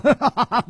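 high, hearty burst of laughter; big laugh. Acted. Nangong Ling mocks his accuser, big laugh. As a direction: throw out a triumphant laugh. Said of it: "南宫灵大笑".

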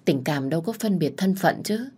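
ordinary delivery, nothing marked

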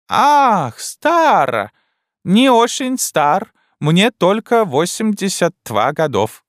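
put-on high, slow, broken-Russian old Frenchwoman; a bewildered elderly French wife, high and slow; old Frenchwoman puzzled by her husband; old French lady. Old French lady's lines.

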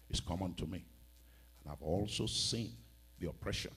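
normal talking voice

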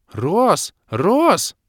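high, loud squeal of the name; stunned girl calling out; shocked exclamation. A narrator's reading. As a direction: cry out his name in shock. Kira shrieking in shock.

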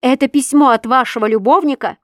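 loud, high accusing question; angry interrogation. A narrator's reading. Husband questions her about the letter, angry interrogation.

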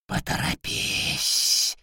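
angry slow hiss; hissing demon. Acted. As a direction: hiss it out with malice. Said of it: "злобно прошипела ряба".

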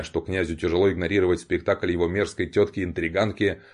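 plain reading voice

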